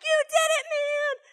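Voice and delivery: high, cheering praise; excited praise. Retold or imitated. Teacher cheering a boy's high score, high and excited. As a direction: cheer him on.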